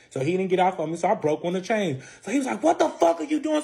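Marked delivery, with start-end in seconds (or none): 2.6-3.6 high-pitched outraged protest; acted angry disbelief; retold mad outburst. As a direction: imitate him mad, voice shooting up. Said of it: "So he's mad".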